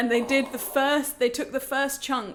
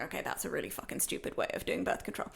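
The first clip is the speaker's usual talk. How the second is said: much lower, softer, fast whispered thought; muttered aside; 7 semitones lower, 8 dB quieter, faster than usual. Retold or imitated. Patient's private verdict on the injection, low whispered mutter. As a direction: mutter it under your breath, fast. Inner reaction, voice drops.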